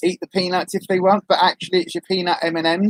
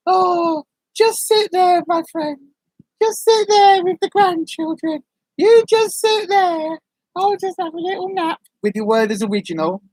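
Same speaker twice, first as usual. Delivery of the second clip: put-on old granny voice; 11 semitones higher than usual. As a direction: play a sleepy old nan. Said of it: "You'll be like".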